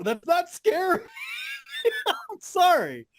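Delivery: high-pitched squeal into laughter; cracking up. Real, not acted. Fan mocking a wrestler as not scary, cracking up. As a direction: break into helpless laughter while talking.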